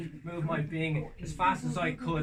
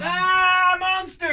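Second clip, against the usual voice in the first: higher, loud fake panic; mock panicked cry; 15 dB louder, much slower than usual. Frightened people crying out about the monster. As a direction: scream like a scared crowd.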